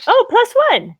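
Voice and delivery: high, loud burst of joy; excited. Real, not acted. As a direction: cheer it out, thrilled. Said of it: "She's so excited about it".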